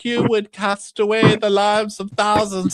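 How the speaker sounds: high-pitched pompous orator; lofty, put-on protest; mock speechmaking. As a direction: imitate someone making a grand little speech. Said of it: "tries to give a little speech".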